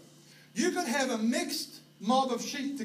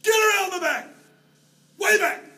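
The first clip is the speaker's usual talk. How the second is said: higher, much louder shout across a valley; yelling at the dog; 10 semitones higher, 10 dB louder than usual. Retold or imitated. New Zealand farmer yells to sheepdog, loud high shout. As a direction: bellow the command so a far dog hears. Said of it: "we would yell".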